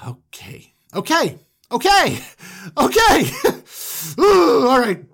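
loud, high nervous pep talk; psyching up. Acted. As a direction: pump yourself up, voice jumping high. Brothers psyching themselves up.

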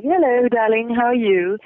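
Jason Isaacs voice. Retold or imitated. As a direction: imitate a polished British gentleman greeting. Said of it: "he's like".

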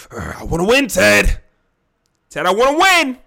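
loud, high, acted shout of frustration; heated outburst. Player demands wins from team owner, heated and shouting. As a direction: pop off at the boss, voice climbing. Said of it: "It's getting a little bit heated".

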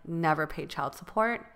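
normal talking voice

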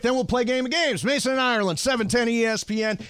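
high, bright announcer read; station ID announcer style; radio station ID. Acted.

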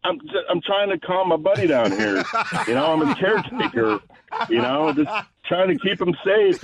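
high, laughing fake-innocent excuse; mock caretaker act. Talking his way out to oil field workers, high laughing innocence. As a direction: play the harmless caretaker, giggling. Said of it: "I'm like".

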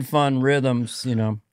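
ordinary talk

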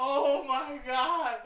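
slow high laughing oh my God. Real, not acted. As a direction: draw it out while laughing. Laughing at own mix-up.

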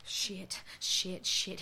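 whispered curses. Acted. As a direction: breathe it out in horror. Horrified witness whispers curses.

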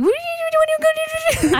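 very high, squeaky pet voice; dog voice. Retold or imitated. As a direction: voice the dog as a character. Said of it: "like a character that we're bringing around".

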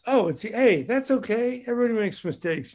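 high, bright-voiced cheerful reassurance; enthusiastic comfort. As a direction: cheer a friend up warmly.

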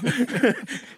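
a sudden high laugh breaking out; real burst of laughter. Real, not acted. Coach laughing at his own hair class story, high real laugh. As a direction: laugh out loud at the memory.

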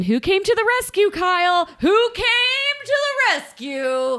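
high, building sing-song question; theatrical high-pitched gossip tease; mock dramatic. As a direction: ham up the rescue question, high and teasing.

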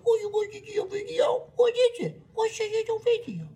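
very high-pitched mocking baby voice; patronizing baby talk. Husband mocking wife's video, in baby talk. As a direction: coo it sky-high, as if to a toddler. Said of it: "just high pitched or it's baby talk".

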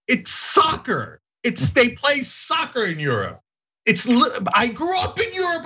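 high-pitched, exasperated mock rant; exasperated arguing. Retelling an argument with friends on a train, exasperated high-pitched protest. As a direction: re-enact a heated argument, voice pushed way up. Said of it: "I'm ripping my hair out".

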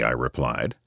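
ordinary delivery

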